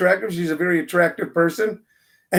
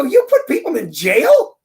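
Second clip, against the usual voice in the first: higher, rising disbelieving question; incredulous; 5 semitones higher, 3 dB louder than usual. Retold or imitated. Host to a tanned TV judge, incredulous question. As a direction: ask it in mock disbelief, pitch swooping up.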